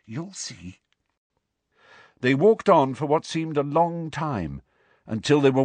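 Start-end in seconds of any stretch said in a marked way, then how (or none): 0.1-0.8 very soft, confiding reply; hushed, knowing answer; quiet answer. A narrator's reading. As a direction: drop to a near whisper. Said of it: "she answered quietly".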